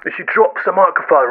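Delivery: usual speaking voice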